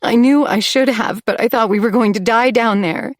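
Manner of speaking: ordinary delivery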